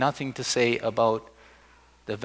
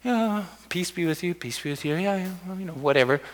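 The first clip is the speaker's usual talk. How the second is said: mock nonchalant; 4 semitones higher, 6 dB quieter than usual. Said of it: "we can be nonchalant".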